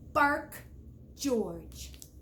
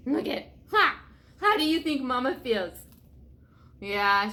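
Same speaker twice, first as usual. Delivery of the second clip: ordinary delivery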